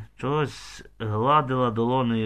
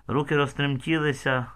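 usual speaking voice

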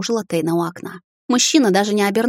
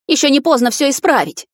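much higher, louder urgent cry; 7 semitones higher, 4 dB louder than usual. A narrator's reading. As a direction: push it out with urgency. Heroine pleads urgently.

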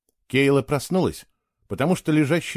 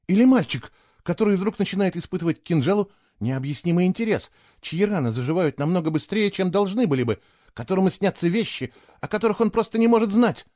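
higher, rushing excitedly to a fast finish; speaking very fast; 7 semitones higher than usual. A narrator's reading. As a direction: tumble the words out faster and faster. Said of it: "она стала говорить очень быстро".

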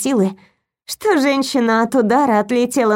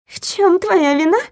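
higher, sharp indignant retort; outraged; 6 semitones higher than usual. A narrator's reading. Lilya outraged at Viktor's question. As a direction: throw his words back, higher. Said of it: "возмутилась Лиля".

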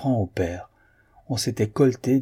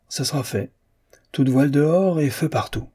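higher-pitched, rallying exclamation; exclamation enthousiaste; 6 semitones higher than usual. A narrator's reading. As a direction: call it out higher, rising to the order. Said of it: "s'écria Guillemard". (s'écrier avec entrain)

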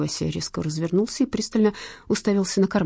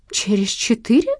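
mildly appalled, higher rising question; a touch of horror, louder; horrified echo; 6 semitones higher, 5 dB louder than usual. A narrator's reading. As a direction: repeat it as a dismayed question. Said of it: "повторила она в ужасе".

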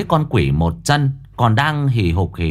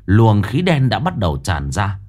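ordinary delivery

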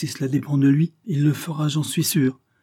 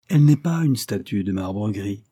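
usual speaking voice

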